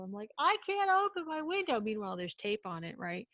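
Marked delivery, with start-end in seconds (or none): 0.4-1.8 high, loud call through a window gap; calling out to the officer, much higher and louder; yelling through the crack. Retold or imitated. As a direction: shout it up and out through a tiny opening. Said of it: "yelling out the little tiny crack".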